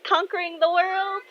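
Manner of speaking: put-on very high, gushing kid voice; excited girl. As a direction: chime in high, thrilled to finish the sentence. Excited girl finishing his sentence.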